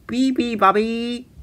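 high shrieking call. A narrator's reading. Seals shrieking the song, high shriek. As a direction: squeal it like a seal. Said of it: "shrieked the seals".

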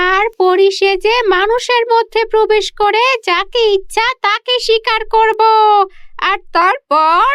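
high, sneering witch voice with a cackle; wicked witch. Acted. Witch gloats after theft. (হিংসুটে ডাইনির উঁচু কর্কশ গলা, অট্টহাসি)

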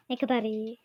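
ordinary talk

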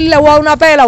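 high and loud scolding threat; loud mock threat. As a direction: belt out the mother's threat. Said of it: "en vez de decir". (amenaza de madre, aguda y fuerte)

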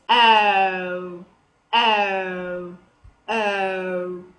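OH drawn out as a long, low gliding vowel; correct O sound. Shown as a demonstration. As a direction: stretch each "oh" slowly into a diphthong. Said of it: "The pronunciation, it will be".

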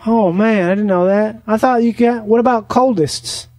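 ordinary delivery

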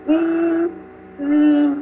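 Uy with lips pursed as if whistling; rounded Uy. Shown as a demonstration. Teacher models ü as Uy, lips held round. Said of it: "giữ nguyên khẩu hình nhá".

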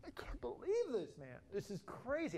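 high, drawn-out amazement; stunned disbelief. Acted. Preacher voicing Peter realizing he is forgiven, astonished. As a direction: stretch it out, high, as if your mind is blown. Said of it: "and he's like".

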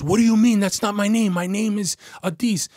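high, loud imitated denial; panicked protest. Suspect stunned, protesting his name.

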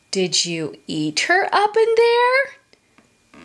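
ordinary delivery, nothing marked

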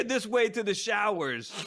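put-on slow German accent, lightly done; hint of a German accent. Retelling the astronaut's line, light German accent. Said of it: "in that accent".

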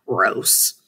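low, grossed-out mutter; disgusted. A narrator's reading. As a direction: recoil in disgust. Disgusted reaction, far, low.

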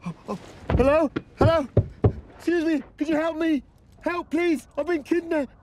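panicked cries for help. Acted. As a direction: shout to any passer-by to save you. Calling out for help.

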